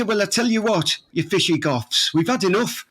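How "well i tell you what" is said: usual speaking voice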